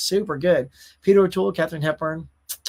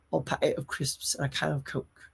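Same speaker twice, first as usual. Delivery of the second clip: a quiet first try at a Scouse accent; tentative Scouse accent, softer than usual; Scouse attempt; 3 semitones lower, 9 dB quieter than usual. Shown as a demonstration. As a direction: have a go at saying it the Scouse way, softly. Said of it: "I'll have to work on that".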